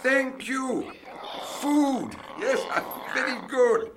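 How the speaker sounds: very high, word-by-word address; talking to foreigners. Acted. Speaking simply to an alien.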